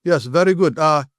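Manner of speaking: mock Russian-accented English. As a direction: imitate Russian trainees praising politely in accented English. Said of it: "that's how they talk. That's the Russian accent".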